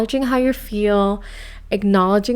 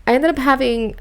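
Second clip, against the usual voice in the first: ordinary talk, nothing marked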